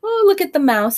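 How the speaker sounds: ordinary delivery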